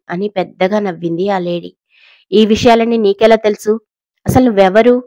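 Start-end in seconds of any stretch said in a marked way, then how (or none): 2.3-3.7 alarmed question, louder and higher; startled fear breaking through; frightened. A narrator's reading. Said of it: "అని భయంగా అడిగింది". 4.3-5.0 a scared "అసలు నువ్వెవరు?" asked higher and louder; fearful demand for who she is; frightened. A narrator's reading. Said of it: "అని భయంగా అడిగింది".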